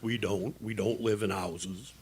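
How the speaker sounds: very soft, low aside; subdued afterthought. Acted. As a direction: trail off into a quiet correction. Muttered correction after his boast.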